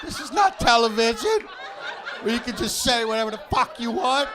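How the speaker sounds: high, loud telling-off of hecklers; real exasperated shout at the crowd; scolding the audience. Real, not acted. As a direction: put the crowd in their place, loud. Comic scolds heckling audience members.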